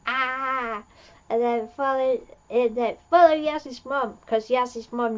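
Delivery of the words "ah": short mock yelp. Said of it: "climbs out and is like".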